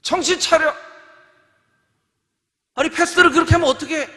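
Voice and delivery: loud, high scolding coach; angry coach at timeout; coach yelling. Pretend. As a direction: chew out the players. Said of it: "감독이 얘기합니다".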